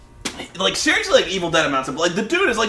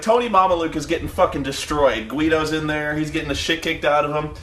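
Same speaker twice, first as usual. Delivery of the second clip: ordinary delivery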